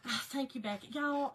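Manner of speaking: usual speaking voice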